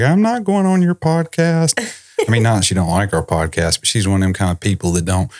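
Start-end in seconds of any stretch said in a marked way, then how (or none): 0.0-1.8 high, brusque imitation of his mom. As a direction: flatly refuse like his mother. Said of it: "she'd probably be like".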